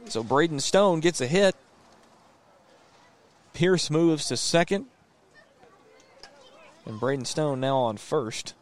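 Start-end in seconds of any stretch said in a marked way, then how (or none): 0.0-4.9 higher, louder call of a close play; rising excitement on the throw; excited play-by-play. Real, not acted.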